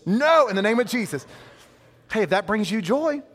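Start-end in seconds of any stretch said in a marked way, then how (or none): 0.1-1.3 higher, louder casting-out; stronger mock rebuke. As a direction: push the rebuke harder the second time. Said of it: "I'm like".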